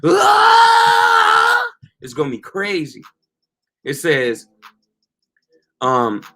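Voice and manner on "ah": huge high screaming roar; Lord's roar. Retold or imitated. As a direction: scream as loud as a roaring lion. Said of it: "you gonna hear every single roar".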